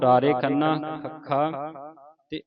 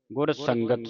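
usual speaking voice

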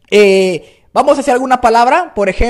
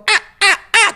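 high, clipped "ah" squawks; cracked-voice squeals; short shrieks; 10 semitones higher than usual. Shown as a demonstration. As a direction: fire off three little cries. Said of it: "con el grito".

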